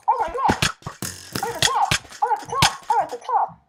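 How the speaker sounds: high excited bragging. Real, not acted. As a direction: shout it, thrilled to lead. Excited boast, repeated high.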